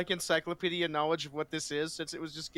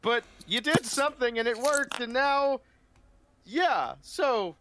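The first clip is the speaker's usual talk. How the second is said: put-on awed young paladin; 9 semitones higher, slower than usual. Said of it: "he just looks at Owen like".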